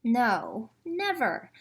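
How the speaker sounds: dramatic storybook denial; emphatic never. A narrator's reading. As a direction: insist on never.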